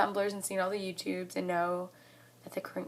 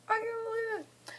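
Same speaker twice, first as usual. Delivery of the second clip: very high, small squeal; tiny high-pitched squeal of disbelief; squeaky disbelief; 4 dB quieter than usual. Real, not acted. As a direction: squeak it out, overcome with excitement. Overcome, repeats in high voice.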